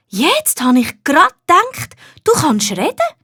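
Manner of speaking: put-on high child's voice wondering aloud; a girl doubting her ears; puzzled little girl. A narrator's reading. Nina shakes her head at the talking gnome, puzzled child. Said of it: "sagt sie".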